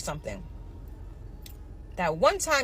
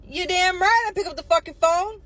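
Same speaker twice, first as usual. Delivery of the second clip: louder, higher, fed-up retort; indignant outburst; 7 semitones higher, 4 dB louder than usual. Real, not acted. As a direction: snap back, offended at the very idea. Ranting about day-off calls.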